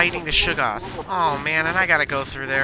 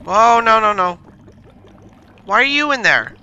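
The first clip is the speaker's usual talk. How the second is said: alarmed; 5 semitones higher, 7 dB louder than usual. Real, not acted. Alarmed at a monster in game.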